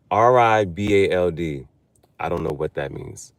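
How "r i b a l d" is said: spells it out letter by letter, slowly; slow, deliberate letter-by-letter spelling. Shown as a demonstration. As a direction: sound out each letter carefully. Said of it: "spelling it out".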